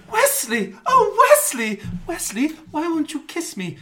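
high, loud, swooning damsel squeal; mock Buttercup. Friend reenacting Buttercup pleading with Wesley, in a high hammed-up squeal. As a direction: ham up a lovesick princess begging. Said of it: "Buttercup runs in".

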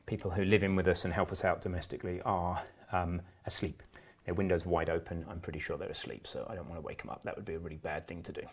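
softly, so as not to wake them; dropping the voice low; quiet at night. Real, not acted. Worried about waking sleeping housemates.

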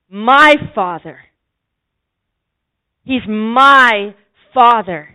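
loud, emphatic, slow preaching; fervent declaration, loud and drawn out. Acted. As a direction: proclaim it to the congregation. Fervent declaration opening the sermon.

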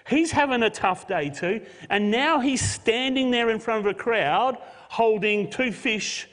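loud, driving sermon delivery; full-force preaching. Real, not acted. Pastor to congregation, loud impassioned preaching.

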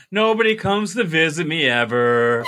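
mock mournful sing-song wail; hammed-up doleful complaint; depressed grandma. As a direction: imitate a self-pitying grandma, drawing out the end. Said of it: "she was so depressed".

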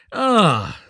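high, loud, drawn exclamation; satisfied miller. A narrator's reading. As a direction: sigh it out grandly. Miller admires the finished work.

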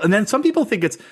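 usual speaking voice

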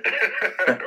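a sudden high burst of laughter; amused laugh at the memory; bright laugh. Real, not acted. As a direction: laugh out loud, high and quick. Laughing at kids' reaction.

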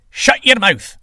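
irate sky god cutting in; angry command. Acted. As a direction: shout down your rival. Sky god orders silence.

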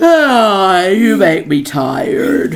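loud, drawn-out weary sigh into words; long-suffering exasperation; exasperated groan. Real, not acted. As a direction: groan it slowly, worn out by them. Exasperated in playful argument.

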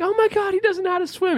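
high frantic mock alarm; panicked mother. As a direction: shriek it like a worried mom. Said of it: "the mother comes running like".

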